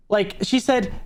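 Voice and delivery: normal talking voice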